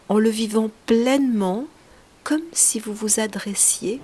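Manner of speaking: ordinary delivery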